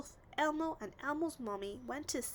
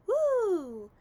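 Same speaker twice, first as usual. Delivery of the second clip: excited woo; 10 semitones higher, 12 dB louder than usual. A narrator's reading. Chair rising.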